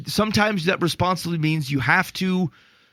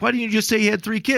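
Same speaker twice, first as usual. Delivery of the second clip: higher, fast incredulous question; exasperated retort; 7 semitones higher, faster than usual. Retold or imitated. To the storyteller, a fast incredulous demand. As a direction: toss it back in disbelief. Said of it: "I'm like".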